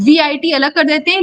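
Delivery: normal talking voice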